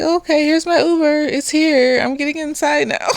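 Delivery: high, breezy brush-off; mock cheerful exit; non-confrontational. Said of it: "non-confrontational".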